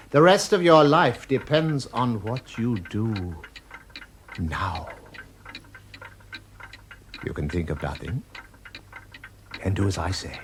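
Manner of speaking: usual speaking voice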